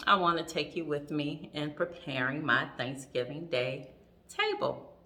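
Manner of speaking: ordinary talk, nothing marked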